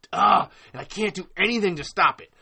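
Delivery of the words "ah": short, loud growl of frustration; exasperated groan. Real, not acted. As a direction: burst out mid-curse with a groan. Breaks off curse with groan.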